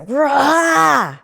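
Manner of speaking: sudden loud cathartic roar; releasing roar. Shown as a demonstration. Roaring to show partner how she discharges anger. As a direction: let out a big discharging roar.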